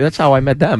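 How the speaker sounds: ordinary talk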